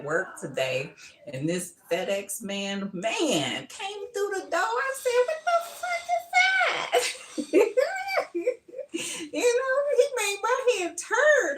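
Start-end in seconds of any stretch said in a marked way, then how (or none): 3.7-11.6 very high, laughing squeal; excited squeal. Retold or imitated. Telling viewers about the FedEx man, squealing with laughter. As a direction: shriek with delight at the sight of him.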